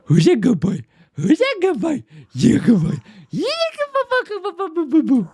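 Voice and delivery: doggy praise voice, up and down in pitch; sing-song good boy baby talk; pet talk. Acted. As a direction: coo at the dog like a proud owner.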